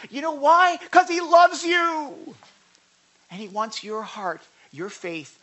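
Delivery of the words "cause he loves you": slow, loud, higher reassurance; drawn-out sincere assurance; slow emphatic love. Real, not acted. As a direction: land it slowly and fully. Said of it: "preaching emphasis".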